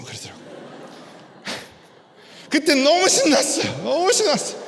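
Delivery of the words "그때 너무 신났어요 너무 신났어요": high-pitched, giddy outburst with a laugh; excited retelling. Retold or imitated. Father recalling his daughter noticing his change, giddily excited. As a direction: relive the thrill, voice leaping up.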